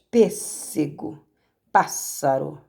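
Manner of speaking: "Pêssego" and "pássaro" said slowly, first syllable "PÊS" and "PÁS" leaning strong; slow stress demo. Shown as a demonstration. Teacher to students, showing where the word is strong, slow. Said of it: "a antepenúltima que é mais forte".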